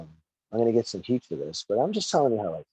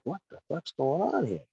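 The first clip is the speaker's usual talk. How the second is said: high, softer baffled question; retold bewilderment; 7 semitones higher, 5 dB quieter than usual. Wrestler recalling being sent to do security, bewildered. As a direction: replay your disbelief under your breath. Retelling his confusion at the order.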